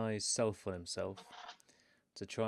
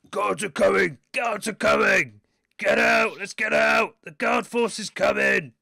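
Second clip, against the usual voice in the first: high, very loud panicked yell; bugbear voice yelling; 12 semitones higher, 16 dB louder than usual. Acted. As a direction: scream the warning at full voice. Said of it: "he's gonna yell at the top of his voice".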